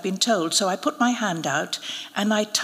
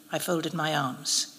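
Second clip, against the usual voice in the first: ordinary delivery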